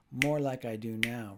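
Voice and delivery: slow, stressed "did", sing-song rhythm; teacher leading choral rhythm practice; rhythmic stress. Shown as a demonstration. Teacher to learners, leading slow rhythmic repetition.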